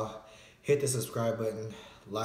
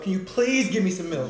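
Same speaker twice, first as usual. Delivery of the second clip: louder, desperate plea for milk; desperate pleading; 9 semitones higher, 9 dB louder than usual. Real, not acted. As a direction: beg for it, desperate. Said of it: "repeats plea louder".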